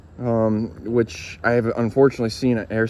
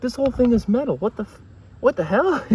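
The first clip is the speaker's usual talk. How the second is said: high mock-astonished voice; amazed; 12 semitones higher than usual. Friend learning the whole body is metal, high astonished voice. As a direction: gasp it out in disbelief.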